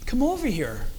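soft, easy summons; gentle invitation. Shown as a demonstration. Showing how tone changes a call to come, gentle. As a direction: beckon kindly, no pressure. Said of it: "but how we say it".

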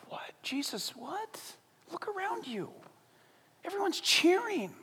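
puzzled hush, pitch climbing; baffled crowd. Retold or imitated. People confused at Jesus' tears, hushed disbelief.

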